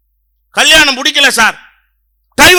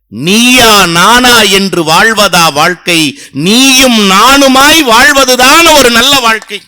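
slow oratory; 2 semitones lower, 4 dB louder, slower than usual. Acted. Orator's punchline.

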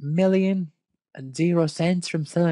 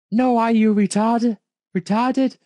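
mocking yell; 6 semitones higher, 5 dB louder than usual. Reading a character's rude retort.

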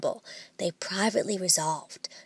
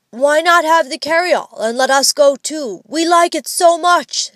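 pleading; 7 semitones higher, 7 dB louder than usual. A narrator's reading. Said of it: "in the pleading tone".

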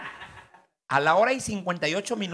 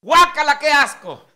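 much higher and louder shriek of disgust; 12 semitones higher, 14 dB louder, slower than usual. Acted. Wife is disgusted by the request, shrieking. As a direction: recoil with a yelled yuck.

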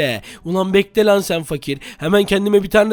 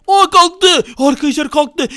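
high, loud excited calls; excited alarm; 6 semitones higher, 4 dB louder, slower than usual. Real, not acted. Player telling viewers the barrier is gone, excited alarm. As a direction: announce it with a sudden jump in pitch. Border lifts, fight about to start.

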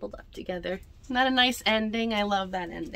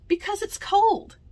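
put-on whiny child; 12 semitones higher, 5 dB louder than usual. Pedro complaining.

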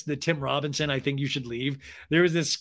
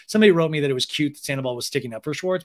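ordinary talk, nothing marked

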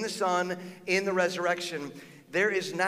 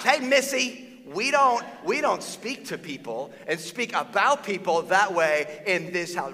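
scolding mom; 3 semitones higher, 4 dB louder, slower than usual. Retold or imitated. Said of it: "there's like".

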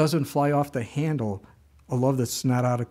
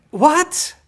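high, loud cry of real disbelief; stunned what; 10 dB louder than usual. Real, not acted. Preacher shocked by the student's answer, loud high disbelief. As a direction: blurt it out, truly amazed.

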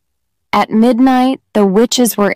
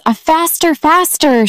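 urging faster, pitched much higher; high excited cry of "faster"; cried out; 8 semitones higher than usual. A narrator's reading. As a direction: spur the dance on, high and eager. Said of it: "she cried".

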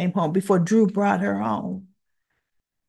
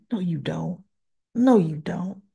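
ordinary delivery

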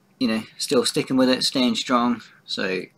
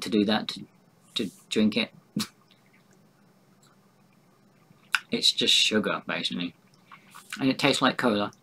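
talking while eating candy; chewing a sweet. Real, not acted. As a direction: mouth partly full. Seen 0: Holds cola sweet throughout, bites it before and at end.

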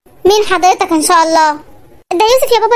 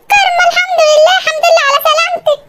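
relieved mother; 10 semitones higher than usual. Acted. Mother greeting daughter waking up, high relieved cry. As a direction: burst out in tearful relief as the mother.

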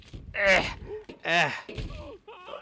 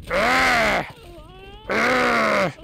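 battle roar; 9 semitones higher, 5 dB louder than usual. Pretend. Battle cry in fight game.

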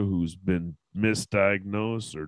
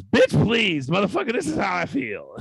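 high, loud sassy outburst; defiant mouth; 12 semitones higher, 5 dB louder than usual. Acted. Mouth overruling the polite brain, defiant shout. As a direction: burst out as the unfiltered mouth. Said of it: "And our mouth says".

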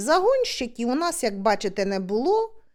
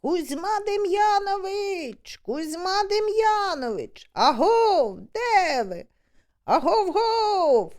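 drawn-out slow calls, pitched higher; long hunting halloo, pitch raised; calling out; 6 semitones higher, slower than usual. Retold or imitated. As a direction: hail a lost friend across the reeds. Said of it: "гукають".

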